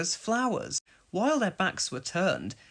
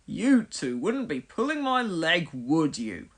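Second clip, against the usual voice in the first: suspicious. A narrator's reading. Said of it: "asked Trevor suspiciously".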